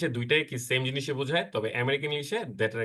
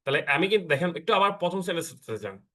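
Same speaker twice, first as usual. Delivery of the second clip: usual speaking voice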